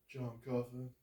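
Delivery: low, hushed and humble; soft low John Coffey. Acted. John answers the sick woman, low and hushed. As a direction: mumble it softly.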